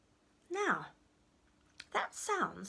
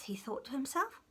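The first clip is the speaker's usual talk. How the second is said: ordinary delivery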